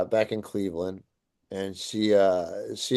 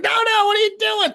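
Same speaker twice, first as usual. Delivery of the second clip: very high, loud alarmed yell; panicked shout; 6 dB louder than usual. Retold or imitated. Friend to drunk man climbing overpass, panicked shouting. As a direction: imitate a friend shrieking to stop someone.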